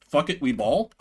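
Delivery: normal talking voice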